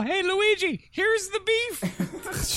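high, loud, slow drawn-out yell. Acted. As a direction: bellow it across the room, stretched. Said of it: "I yell".

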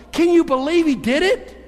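high-pitched, incredulous exclamation; amazed. Acted. Preacher steps back from the story of Jesus, amazed. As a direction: marvel at it in astonishment.

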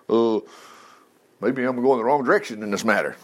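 lightly voiced rattled man, low and hesitant; rattled Paul. Paul rattled to the core on the road, lightly voiced shaken mumbling. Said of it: "He's like".

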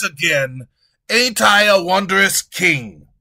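loud, high blustering king; loud, high king scolding his treasurer; pompous ranting king. Acted. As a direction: bluster at the servant.

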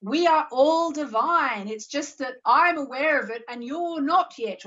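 teacher's quoted voice. Retold or imitated. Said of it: "Amma actually says".

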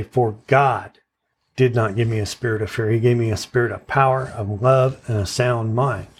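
"God" hit firmly and held, then a pause; stress on God. Shown as a demonstration. Teacher repeats the verse to meditate on it, stress on "God". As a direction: punch the second word "God", then run quickly through the verse. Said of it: "keep moving that emphasis on the next word".

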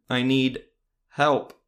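loud, halting, like learning to speak; jerky unnatural voice. A narrator's reading. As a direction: sound like a puppet learning words. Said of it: "off, somewhat jerky".

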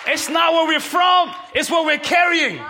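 loud, high, shouted preaching to the congregation; full-force preaching. Acted. Pastor rousing the New Bedford congregation, shouting. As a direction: preach it out, lifting the room.

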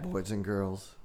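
ordinary delivery

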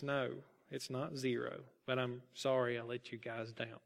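low, soft, contrite answer; quiet voice. A narrator's reading. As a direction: back down softly and apologise. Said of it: "answered in a quiet voice".

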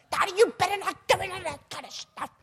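very high put-on squawk; imitated scolding voice. Mother warning against the drugs, mock high-pitched scolding.

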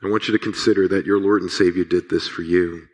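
normal talking voice

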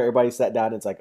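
usual speaking voice

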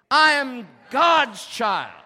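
emphatic high, drawn-out shout; like you mean it. Shown as a demonstration. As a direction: shout it with full conviction. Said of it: "say it like you mean it".

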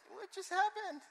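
high, loud, stammering disbelief; hammed-up incredulous yelling; exasperated outburst. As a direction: shriek it as the flabbergasted wife. Acts exasperated wife's outburst.

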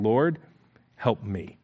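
soft, low, near-whispered plea; a hushed broken plea for help. Acted. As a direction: beg quietly from your knees. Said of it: "she came and knelt before him, saying".

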